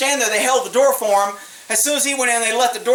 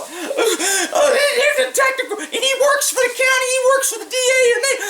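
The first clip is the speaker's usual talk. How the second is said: high falsetto whine; mocking whiny falsetto; mock crying man; 11 semitones higher than usual. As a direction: whine like a tattling crybaby on the phone. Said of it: "he's crying to county council".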